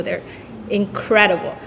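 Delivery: usual speaking voice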